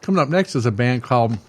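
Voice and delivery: ordinary talk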